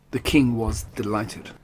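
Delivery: ordinary delivery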